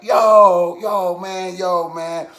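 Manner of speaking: loud first yo, high and excited; gushing fan. Retold or imitated. Fan gushing after getting a picture, excited. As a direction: imitate a thrilled fan.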